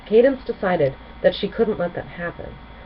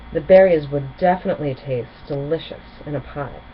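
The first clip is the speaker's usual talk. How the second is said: ordinary delivery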